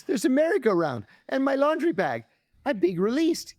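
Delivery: can't believe his luck, voice jumping up; excited disbelief. Retold or imitated. Prisoner thrilled about release.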